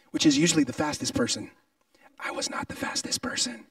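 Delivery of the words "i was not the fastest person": whispered confession; a whispered admission to the congregation. Real, not acted. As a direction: lean in and whisper it. Confessing aside.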